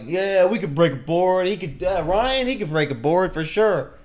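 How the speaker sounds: high, loud hyped-up buddies; imitating rowdy friends backing him up; rowdy egging-on. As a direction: cheer your friend on, loudly.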